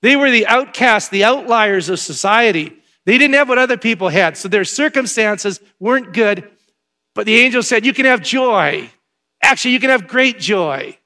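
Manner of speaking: loud, high preaching build. Acted. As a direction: preach it to the back row. Preaching at full force.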